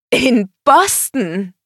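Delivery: high, loud, stretched-out exclamation; amazed. A narrator's reading. Woman discovers the concert is abroad, amazed. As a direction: cry out the city in astonishment. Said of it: "ihre Augen weiteten sich".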